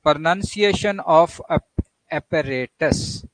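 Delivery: apparatus said slowly, syllable by syllable; teacher's slow model word; pronunciation demo. Shown as a demonstration. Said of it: "know your English, pronunciation of".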